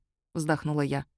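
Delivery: usual speaking voice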